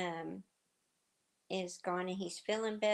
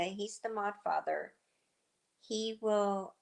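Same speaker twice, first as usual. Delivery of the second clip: normal talking voice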